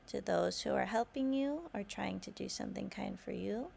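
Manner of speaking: ordinary delivery, nothing marked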